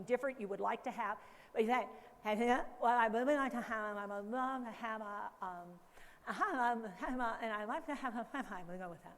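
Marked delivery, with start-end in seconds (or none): 2.2-8.4 slurred, mushy words with the tongue held flat; flattened tongue. Shown as a demonstration. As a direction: talk with a flat tongue so it comes out garbled. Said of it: "flatten your tongue out a little bit".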